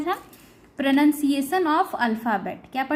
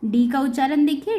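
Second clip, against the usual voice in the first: usual speaking voice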